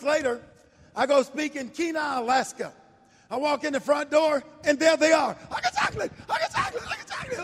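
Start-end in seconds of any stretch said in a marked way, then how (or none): 5.5-7.4 high, excited chant, over and over; excited screaming. Retold or imitated. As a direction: greet him like a long-lost friend. Couple greets him again, excited.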